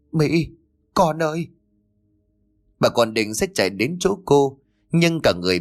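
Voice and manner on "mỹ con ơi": sobbing call. Acted. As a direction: cry out to the lost daughter. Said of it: "bà Tư nức nở gọi lớn". (mẹ nức nở gọi lớn tên con)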